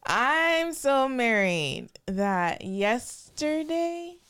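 giggling through the words, high and slow; laughing too loud to get the story out. Real, not acted. Laughing loudly while teasing her husband about marriage. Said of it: "That is loud".

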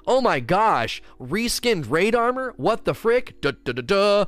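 hammed-up gasp at reskinned armor; mock outrage. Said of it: "It's like".

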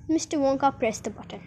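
plain reading voice

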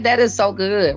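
ordinary talk

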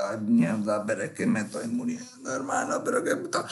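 soft, strained moan of pain; mock agony. Cramping on a rock, moaning in pain. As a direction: groan like you're dying on the toilet.

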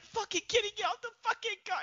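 high, fast and annoyed; mock angry yell. Reporter snapping at Kenny to get off the car, high and fast. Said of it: "she's like".

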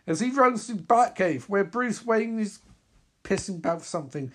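ordinary delivery

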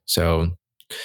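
ordinary talk, nothing marked

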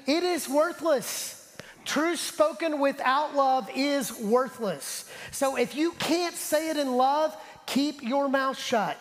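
slow, high, forceful preaching; preacher turning harsh on the truth speakers. Real, not acted. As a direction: hammer it home, blunt and slow. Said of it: "That sounded harsh".

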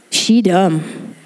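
low, blunt "She dumb" with a sassy drop; deadpan mock diss, pitched low; sassy put-down. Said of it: "Jesus said it like that".

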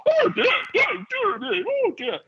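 high, laughing mock dismay; hammed-up high-pitched fretting; flustered squeal. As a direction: imitate a receptionist squealing in a fluster. Imitates Alison's reaction to the key.

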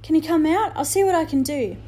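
put-on anxious boy. A narrator's reading. Omri worried for Indian.

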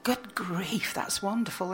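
breathy whisper of delight; whispered thought. Retold or imitated. Poet retelling her inner reaction, whispered.

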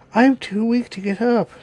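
put-on high-pitched frail old lady; old grandmother. A narrator's reading. Grandmother too weak to rise, high old-lady voice. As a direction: call from bed in a high old voice.

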